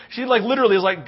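ordinary talk, nothing marked

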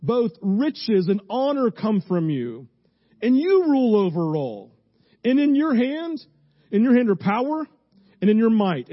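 loud, high, forceful scripture reading; preaching scripture. Acted. Pastor proclaiming First Chronicles to the congregation, loud and high.

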